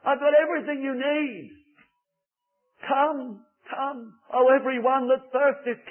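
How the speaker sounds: high, loud impassioned preaching; fervent preaching. Acted. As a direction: plead with the whole congregation. Preaching at full force on Christ's call.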